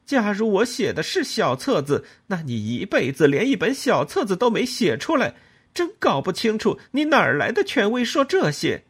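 高声尖锐地顶回去; 被轻视后愤然回击; 愤怒反驳. A narrator's reading. 和公公争吵反驳. (高声愤怒地反驳公公)

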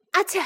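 quick acted sneeze. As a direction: sneeze again with a little burst. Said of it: "又打了个喷嚏".

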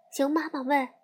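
usual speaking voice

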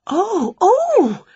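high, rising cries of amazement; overjoyed high "oh, oh"; thrilled gasp. A narrator's reading. Winnie amazed by the reply.

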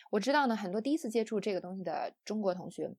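ordinary talk, nothing marked

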